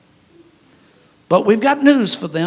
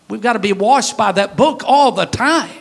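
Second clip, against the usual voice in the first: normal talking voice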